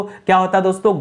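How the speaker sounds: ordinary delivery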